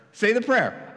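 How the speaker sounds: high, loud, overjoyed salesman-like pitch; eager evangelist. Acted. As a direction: gush at the new convert like a cheesy preacher. Evangelist insists.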